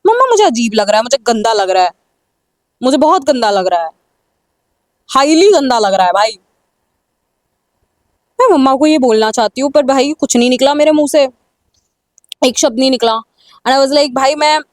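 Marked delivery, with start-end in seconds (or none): none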